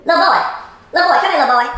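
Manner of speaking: put-on loud summons to a child; calling out sternly. Woman filming calls interrupting little boy, stern shout. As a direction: call him over, commanding.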